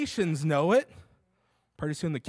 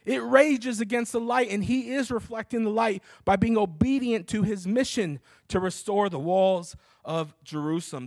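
higher, louder preaching build; full-force preaching; 6 semitones higher, 6 dB louder than usual. Acted. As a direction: preach it with fire.

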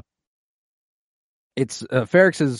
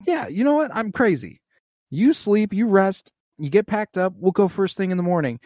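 much higher, near-shouted eager agreement; forced cheer; 8 semitones higher than usual. Retold or imitated. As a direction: overeager son covering worry. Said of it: "He's like".

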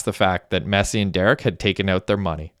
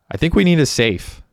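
higher, lightly raised call; a mild narrated yell, pitched up; 8 semitones higher than usual. A narrator's reading. Messi calling out at home, a mild high yell. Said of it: "Messi yelled out".

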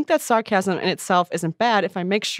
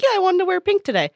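casual reply; 11 semitones higher, faster than usual. Acted. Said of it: "And you'd be like".

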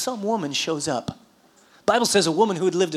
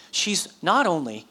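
ordinary talk

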